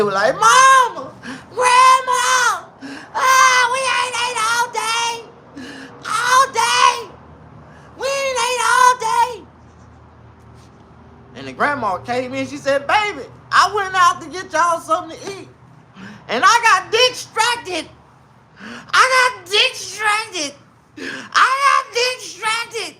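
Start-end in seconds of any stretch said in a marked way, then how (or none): 0.3-9.5 put-on high, slow sing-song child whine; whining kids. Hungry grandkids whining to grandma, high and drawn out. 12.9-23.0 high, slow mock-tearful granny; guilty grandma. Grandma excusing herself to hungry kids, slow mock sob.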